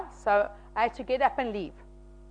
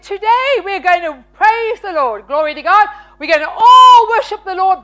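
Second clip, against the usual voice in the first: high, loud, rousing exhortation; rally a congregation to praise; over-enthusiastic retold call to worship; worship leader's call; 5 semitones higher, 7 dB louder than usual. Retold worship-leading to near-empty room.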